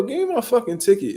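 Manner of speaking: high-pitched retold exasperation; mock fed-up demand. Driver demands ticket from officer, mock exasperation. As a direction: demand it as if at wits' end.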